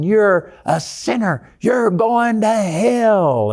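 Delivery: high, slow, drawn-out hellfire preacher; gravelly screaming preacher. Retold or imitated. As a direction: imitate a gravelly preacher dragging words out. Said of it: "they'll draw the word out and they'll scream".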